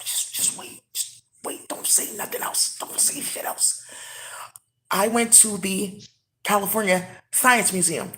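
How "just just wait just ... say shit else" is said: very soft, voiceless hushing; whispering. Real, not acted. Telling someone to wait and say nothing else, whispering. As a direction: whisper a warning, haltingly. Hushes someone after long pause.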